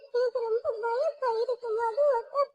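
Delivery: high, teary sobbing plea; scared little girl crying to her big sister; crying in fear. Acted. Child terrified, crying.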